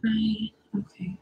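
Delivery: slow whispered aside to herself. Real, not acted. Streamer whispering to herself while setting up, slow whisper. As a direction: breathe it out under her breath, slowly.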